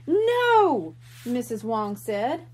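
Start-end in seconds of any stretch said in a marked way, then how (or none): 0.1-0.9 sharp, high cry of disbelief; shocked. A narrator's reading. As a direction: gasp it out in alarm. Shocked exclamation at news.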